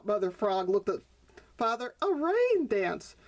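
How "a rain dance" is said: high, slow delighted surprise. Acted. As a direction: savour the words, amazed. Mother frog delighted.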